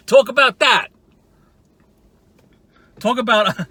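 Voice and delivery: ordinary talk, nothing marked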